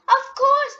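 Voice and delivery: high, bright, loud "of course"; cheerful mother. Acted. Mummy Pig happily agreeing to her daughter, chirpy and high. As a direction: beam it out as a delighted mum.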